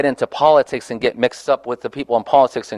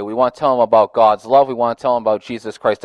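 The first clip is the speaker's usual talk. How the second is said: usual speaking voice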